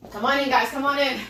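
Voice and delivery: ordinary delivery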